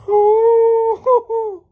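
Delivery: very high, loud fake sobbing hoot; hammed-up falsetto boo-hoo wail; mock wail. Mock crying as the witch.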